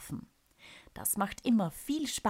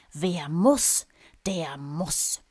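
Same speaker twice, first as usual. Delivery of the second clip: pressed out; 6 dB louder, much slower than usual. Acted. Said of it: "presst er hervor".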